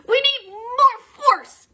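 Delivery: high, slow, effortful grunt; grunting strain. Acted. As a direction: push through gritted teeth.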